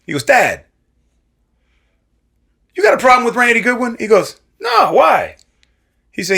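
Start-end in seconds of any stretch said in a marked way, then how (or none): 0.2-4.0 high, loud phone question. Retold or imitated. Mickey calling his dad. 4.6-5.4 high, loud surprised reply. Retold or imitated. Dad's reply on phone.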